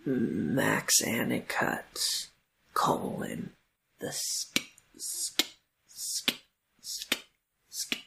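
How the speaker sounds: ASMR whisper. Pretend. ASMR name reading.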